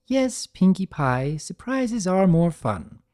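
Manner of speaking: indulgent. A narrator's reading. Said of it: "sighed and gave in".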